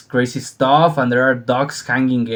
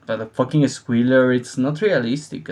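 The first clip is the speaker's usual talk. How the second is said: normal talking voice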